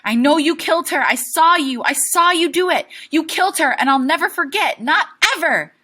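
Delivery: high, loud, relentless screaming; screaming accusation. Acted. Boy screaming at his father, who is choking Loretta. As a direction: keep screaming it at him. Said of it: "keep screaming".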